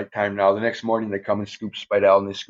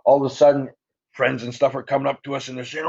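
ordinary talk

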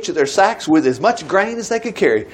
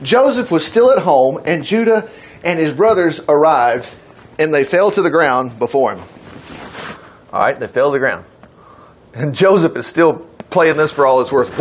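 usual speaking voice

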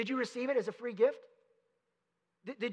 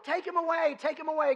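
mock crowd yell, higher and a bit louder; crowd shouting; 6 semitones higher, 4 dB louder than usual. Preacher retelling the crowd's cry to Pilate, mock shouting. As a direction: call it out like the crowd demanding. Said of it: "But they shouted".

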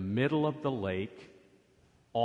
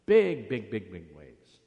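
building big waves; 6 dB louder than usual. Acted. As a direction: paint the huge waves with a loud burst that fades. Describing storm waves to children.

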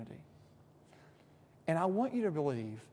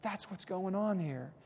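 normal talking voice

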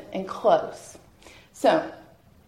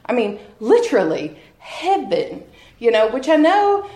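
ordinary delivery

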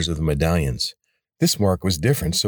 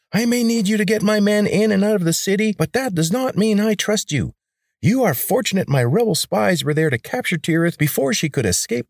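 high grating rebel leader; 13 semitones higher than usual. A narrator's reading. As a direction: bark orders in a high, grating voice. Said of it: "the irritating voice came back".

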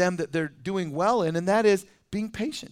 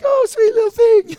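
much higher, louder cooing mom; doting mom voice; 12 dB louder than usual. Retold or imitated. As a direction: coo over the baby like a doting mother. Doting mom with messy baby.

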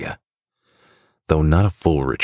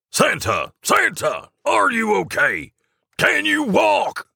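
very high-pitched shouted elf voice, slowing at the end; loud calling out; slower than usual. Acted. Elf checking on fallen Santa, calling out loudly. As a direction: call out urgently in a squeaky high voice. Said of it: "says Jingleman loudly to Santa".

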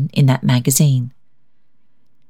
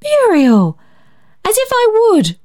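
high, shocked cry of protest; elder sister horrified, crying out; shocked horror. A narrator's reading. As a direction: recoil in flushed horror at the idea. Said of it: "cried Barbara in flushed horror".

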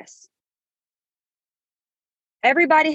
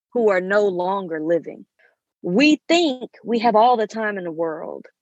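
ordinary delivery

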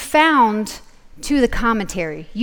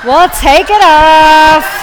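slow, high, loud call; loud drawn-out exclamation; 5 semitones higher, 11 dB louder, much slower than usual. Real, not acted. Speaker to audience about a troublesome earring, loud drawn-out exclamation. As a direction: stretch it out loudly over the noise. Exasperated fuss over noisy earring.

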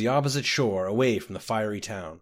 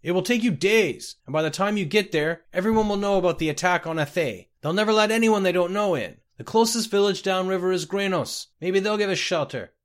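high, raised voice carrying over water; high, insistent calling; calling out urgently; 7 semitones higher, 3 dB louder than usual. A narrator's reading. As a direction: urgently argue with someone out of reach. Captain calling after swimming Scotty.

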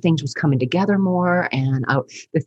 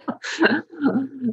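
a bright, higher giggle; real easy laughter; high light laugh; 10 semitones higher, 3 dB quieter than usual. Real, not acted. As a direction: laugh with relief. Laughing at relief.

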